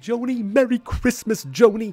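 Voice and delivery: high, loud mock holiday greeting; cheery Al. Al arriving with a tree, loud cheery greeting to Joni. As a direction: belt the name out like a jolly diner owner. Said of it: "and he goes".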